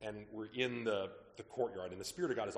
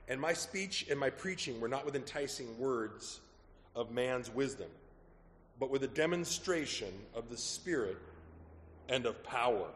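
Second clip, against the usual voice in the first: slow, louder, measured Bible reading; scripture reading; 5 dB louder than usual. Acted. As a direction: read it as proclamation, slowly. Reads scripture aloud.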